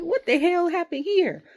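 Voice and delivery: high, loud incredulous question; reenacted exasperated disbelief; baffled outburst. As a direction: blurt it out in sheer bafflement. Retells bafflement at the match.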